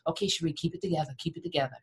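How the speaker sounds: soft, fast hush, telling her to hold it in; pat-on-the-back murmur to stop the tears; hushed soothing. Retold or imitated. Friends hushing a crying daughter, soft and quick. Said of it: "they tap me on the back and say".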